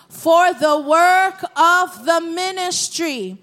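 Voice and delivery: stretched-out, weighty scripture phrase; slow emphatic rereading. Real, not acted. Preacher returns to the verse after questioning the saints, slow and emphatic. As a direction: draw out "For the work of the ministry" word by word.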